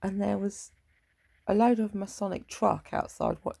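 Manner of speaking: ordinary talk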